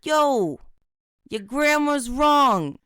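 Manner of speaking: exaggerated streetwise accent, slow and brash; put-on accent. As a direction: ham up a tough city accent. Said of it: "That's a really good accent".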